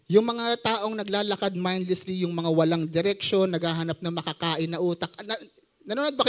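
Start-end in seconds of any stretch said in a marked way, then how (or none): none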